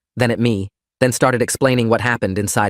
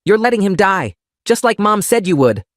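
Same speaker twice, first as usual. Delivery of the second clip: much higher tearful accusation; desperate accusation; 10 semitones higher, 3 dB louder than usual. Acted. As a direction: hurl it at him through tears. Girl accusing father.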